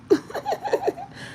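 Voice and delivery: high, breathy squeal of laughter; real giggle fit, high and soft; high squealing giggle at her friend. Real, not acted.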